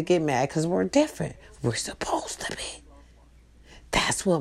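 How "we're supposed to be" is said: soft unvoiced whisper; whispered aside. Real, not acted. Telling her family they are meant to differ, whispered. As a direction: let it slip out as a whisper.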